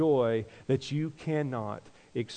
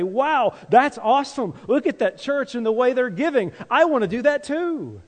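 high, loud gushing wow; mock excited churchgoer, much higher and louder; excited Corinthians; 10 semitones higher, 6 dB louder than usual. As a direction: sell it like an awestruck fan.

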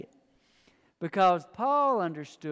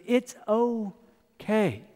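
gentle reassurance; 4 semitones higher, much slower than usual. Retold or imitated. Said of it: "And he goes".

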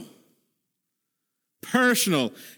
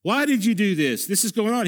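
higher, pleading cry of why; raised voice, crying out to God; anguished cry to God; 5 semitones higher than usual. Acted. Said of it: "He cries out to the Lord".

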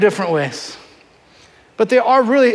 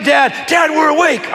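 put-on kids calling out; 6 semitones higher, slower than usual. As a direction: call out like eager little kids. Kids calling dad awake.

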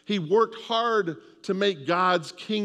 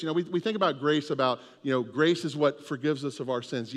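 ordinary talk

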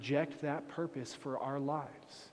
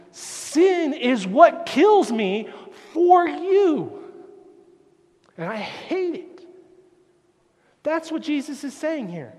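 loud, soaring preaching that slows to hammer points home; full-force preaching; 10 semitones higher, 17 dB louder than usual. Acted. Preacher thundering the cost of sin at his congregation, full-force preaching. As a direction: preach it out at the top of your voice.

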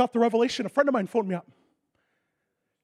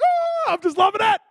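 much higher, loud joyful shout; happy dance shout; 13 semitones higher, 9 dB louder than usual. Retold or imitated. Preacher doing his happy dance at the news, joyful shout. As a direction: whoop it out with joy. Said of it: "That was my happy dance".

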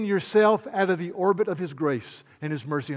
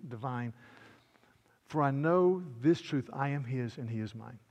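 soft, lower, reverent verse reading; hymn recitation; 3 semitones lower, 4 dB quieter than usual. Acted. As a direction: recite the hymn gently and low.

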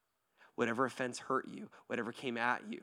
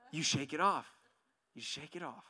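usual speaking voice

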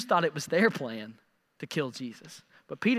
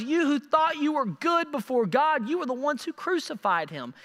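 usual speaking voice

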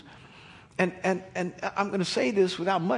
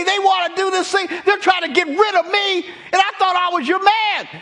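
high, loud protest at God; exasperated shouting; 14 semitones higher, 8 dB louder than usual. Retold or imitated. Moses complains to God about the trapped people: loud exasperated shouting. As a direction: rant at God like he's been set up to fail. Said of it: "You know what it sounded like".

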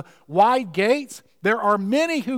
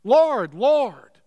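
louder, higher double Lord; fervent cry; 4 semitones higher, 5 dB louder than usual. Retold or imitated. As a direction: plead with fervour, stressing each Lord. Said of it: "and they're fervent".

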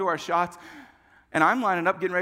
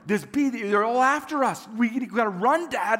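put-on higher, urgent boyish alarm; hammed-up frantic warning; mock panic; 4 semitones higher, 3 dB louder than usual. Frightened boy urging his dad to flee the bees, mock panic.

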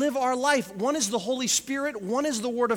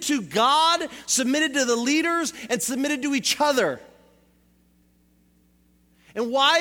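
louder, driving sermon delivery; impassioned preaching; 3 semitones higher, 5 dB louder than usual. Real, not acted. Preacher to congregation on the early church, impassioned preaching. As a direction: build it up like a preacher rallying the church.

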